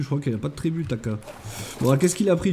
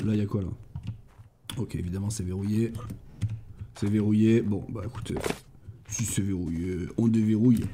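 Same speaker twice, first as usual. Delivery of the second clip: much softer, lower muttering; 5 semitones lower, 8 dB quieter than usual. Real, not acted. Streamer muttering to himself at a locked chest, soft and low. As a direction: mutter it under your breath.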